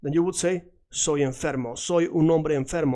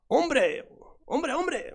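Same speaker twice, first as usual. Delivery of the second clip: high-pitched, playful calling of "hombre"; exclaimed hombre; 11 semitones higher, slower than usual. Shown as a demonstration. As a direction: toss out a much higher "hombre" that falls away. Playful exclamation of familiar word.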